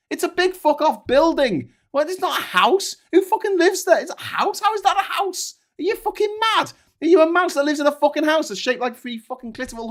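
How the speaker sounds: furious rant. Real, not acted. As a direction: let the outrage boil over, shouting. Ranting about the Opera House.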